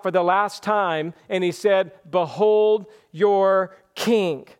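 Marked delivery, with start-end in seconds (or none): 2.1-4.5 slow, weighty pronouncement; slow solemn reading. Acted. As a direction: spread the words out deliberately. First reading of Pilate's words.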